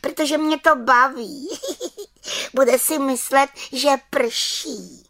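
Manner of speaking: giggly witch. Acted. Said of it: "Čarodějnice se pochechtávala".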